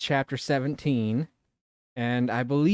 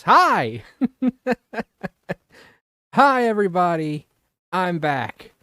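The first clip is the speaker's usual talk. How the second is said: high, loud, bursting hello with a laugh; excited greeting; 5 semitones higher, 6 dB louder than usual. Real, not acted. Streamer back after a week greeting chat excitedly. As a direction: greet them like you missed them, laughing.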